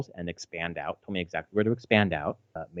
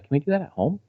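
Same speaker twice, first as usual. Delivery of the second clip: put-on higher-pitched, hopeful child's plea; lightly voiced daughter; 11 semitones higher than usual. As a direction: imitate a kid asking eagerly. Voicing daughter's eager request.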